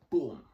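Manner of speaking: loud punchy boom sound effect; a loud mock punch sound. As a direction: slam out the impact noise. Imitated impact in a fight story.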